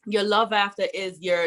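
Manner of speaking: usual speaking voice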